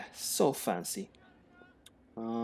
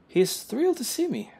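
1 swooning posh voice; 13 semitones higher than usual. Acted. Voicing character.

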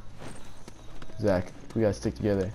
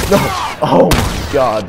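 drawn-out horrified yell; loud, slow cry of horror; anguished shout; 4 semitones higher, 12 dB louder, slower than usual. Real, not acted. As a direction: scream it as the team dies. Teammates being slaughtered.

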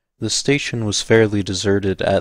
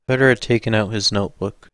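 ordinary delivery

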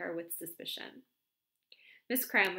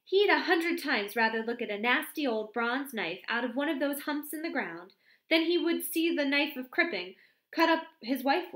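a lightly voiced gossipy girl, a touch higher; bright chatter, eager to gossip; chatty young secretary; 5 semitones higher, 6 dB louder than usual. A narrator's reading. Miss Cram gossiping to the vicar's wife, lightly voiced.

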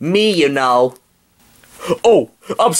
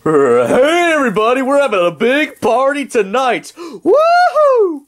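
party shout; 8 semitones higher than usual. Acted. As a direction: burst in yelling for a party. Cat in the Hat bursts in.

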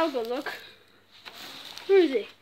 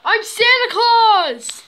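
excited; 7 semitones higher, 7 dB louder, slower than usual. Acted. Covered in snow, joking.

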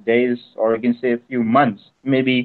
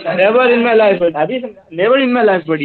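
excited; 6 semitones higher, 4 dB louder than usual. Real, not acted. Amazed praise for scamming him.

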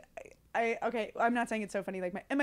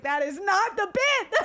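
higher, louder mock-indignant protest; retold protest; 9 semitones higher, 5 dB louder than usual. Comic retelling her objection to the audience member, emphatic protest. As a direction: insist it, raised and emphatic.

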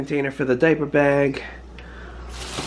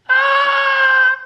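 loud, very high cry; high-pitched yell; 5 dB louder than usual. Real, not acted. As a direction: yell out at your own voice. Yells at own sick voice.

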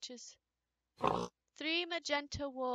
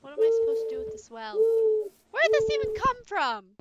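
nervous; 6 semitones higher, 8 dB louder, faster than usual. Real, not acted. Scared at the well.

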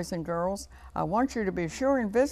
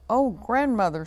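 higher, mildly startled exclamation; crying out; 5 semitones higher, 6 dB louder than usual. A narrator's reading. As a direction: exclaim in mild storybook surprise. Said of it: "she cried".

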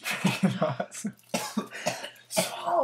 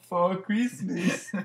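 laughing; 4 semitones higher, 3 dB louder, much slower than usual. Acted. Slow blooper retake, laughing.

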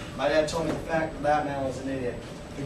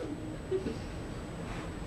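breathy, crying sigh of defeat; broken sigh; 10 semitones higher, 12 dB quieter than usual. Retold or imitated. Drill sergeant falls to pieces, crying sigh. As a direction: let it all out in a sob-like sigh. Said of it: "The guy just fell to pieces".

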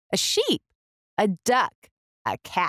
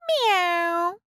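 cat meow; 10 semitones higher than usual. A narrator's reading. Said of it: "said Cat".

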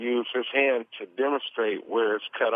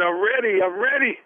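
tearful plea; 10 semitones higher, 3 dB louder than usual. Retold or imitated. Crying, calling grandmother at night.

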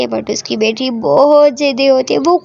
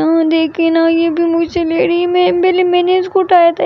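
put-on little girl voice; 6 semitones higher than usual. Other girl complains too.

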